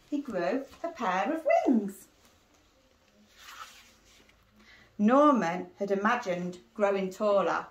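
put-on lively read-aloud for young children; teacher reading a storybook to her class; story narration. A narrator's reading. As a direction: read it like a bedtime picture book. Reads picture book to pupils.

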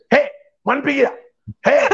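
high, far-off bark of a father; stern father calling out. Retold or imitated. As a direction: call out like a strict old dad. Father's way of showing love.

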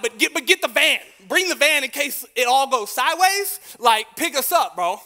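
loud, high, urgent request; urgent plea. Retold or imitated. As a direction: push hard, you need that van. Teen urging dad to bring van.

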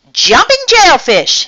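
high, loud storybook outburst; startled cry. A narrator's reading. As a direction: exclaim in shock at a discovery. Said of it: "he cried".

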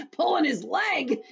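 high, loud, acted cackling words; hysterical laughter. A narrator's reading. Centipede mocking the earthworm, in hysterics. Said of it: "sent the centipede into hysterics".

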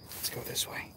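unvoiced whisper while sneaking; whispering to viewers while creeping away. Real, not acted. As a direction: hush and breathe the words. Sneaking off alone.

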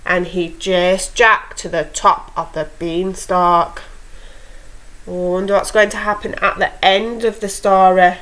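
put-on lively read-aloud for young kids; storytelling to children. A narrator's reading. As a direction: tell it like a bedtime fairy tale. Teacher reads fairy tale aloud.

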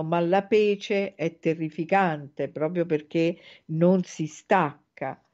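usual speaking voice

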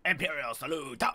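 barked soldier salute; army voice. Pretend. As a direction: play a commanding officer. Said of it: "My army voice".

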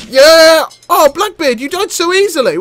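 high, loud victory whoop; triumphant cheer. Real, not acted. Gamer crowing after beating Blackbeard, loud gleeful whoop. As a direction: crow over the fallen boss.

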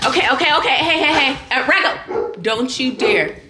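loud, high warning; urgent calling out. Real, not acted. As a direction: call out sharply to stop mischief. Calling out to stop Rocco.

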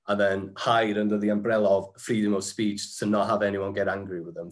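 normal talking voice

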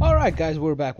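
high, loud vlogger greeting; energetic intro. Real, not acted. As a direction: welcome viewers back brightly. Vlog return intro.

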